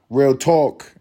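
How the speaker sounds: mock podcaster. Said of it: "you hear people".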